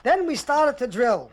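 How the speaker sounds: ordinary delivery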